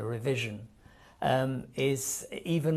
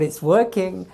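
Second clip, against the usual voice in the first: louder, higher gleeful "it's working"; excited thrill; 3 semitones higher, 8 dB louder than usual. Retold or imitated. Host recalls fooling his Italian friend, louder gleeful triumph. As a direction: let the delight burst out.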